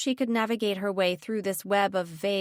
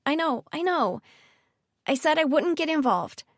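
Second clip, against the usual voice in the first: impatient; 8 semitones higher, 3 dB louder than usual. Acted. Ridley cutting off her dad.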